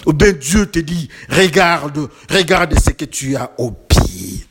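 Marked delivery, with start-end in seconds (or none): none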